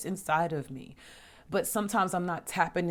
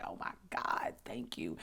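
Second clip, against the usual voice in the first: grateful hush; 9 semitones lower, 8 dB quieter than usual. Retold or imitated. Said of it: "I was like".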